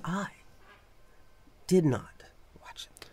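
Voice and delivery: hushed confession, breath only; whisper. Real, not acted. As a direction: drop to a whisper as if admitting something.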